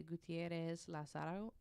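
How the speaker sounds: slow careful Spanish: Gutierrez with a tapped r, Lazaro said "LA-sa-ro"; unsure attempt at Gutierrez Lazaro in Spanish; Spanish pronunciation. Real, not acted. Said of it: "you speak Spanish the best of all of us".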